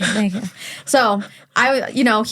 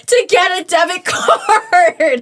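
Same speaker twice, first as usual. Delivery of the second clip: slow, higher, laughing mid-word; laughing at herself; 8 semitones higher, 4 dB louder, much slower than usual. Real, not acted. Admitting to friends she drove him to the bank, laughing. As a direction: crack up at the absurdity.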